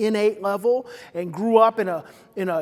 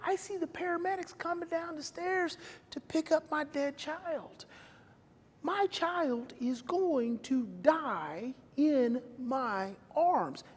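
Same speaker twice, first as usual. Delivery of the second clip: grief-stricken; 3 semitones higher, 9 dB quieter than usual. Real, not acted. Imagining his dead child.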